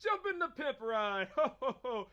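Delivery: jolly laughing invite; laughing Santa. Acted. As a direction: chuckle it like Santa.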